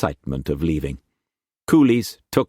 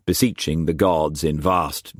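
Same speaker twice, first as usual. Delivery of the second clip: plain reading voice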